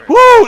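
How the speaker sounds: a sudden high, loud woo; loud whoop. Real, not acted. Whooping in a comic rant.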